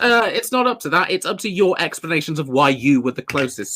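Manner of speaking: ordinary delivery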